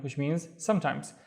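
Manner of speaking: ordinary talk, nothing marked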